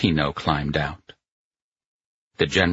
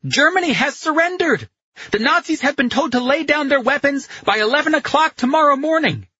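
high, loud shouted announcement; excited shouting; 5 dB louder, faster than usual. A narrator's reading. Carletto shouting radio news of the surrender to Pino, excited shouting. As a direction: shout the news, building to a quick rush. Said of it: "Carletto shouted".

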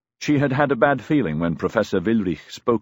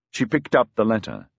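usual speaking voice

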